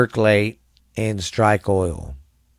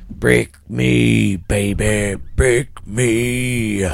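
tough-guy gangster growl; mobster voice; much slower than usual. Acted. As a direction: play it like a movie mobster. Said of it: "I love that mobster stuff".

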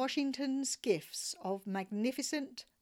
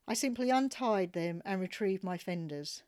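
ordinary delivery, nothing marked

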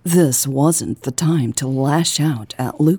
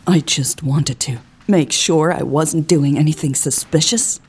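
ordinary delivery, nothing marked

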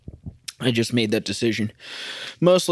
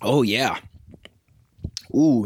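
ordinary delivery

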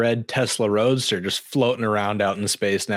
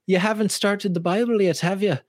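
high-pitched imitation of an Irish lady; lightly put-on Irish lilt; Irish woman; 7 semitones higher than usual. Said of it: "this tiny little Irish woman".

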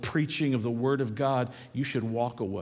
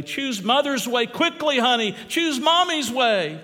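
much higher and louder, slow begging cry; anguished plea; 11 semitones higher, 7 dB louder, slower than usual. Acted. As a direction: beg her desperately to choose. Father begs dying daughter.

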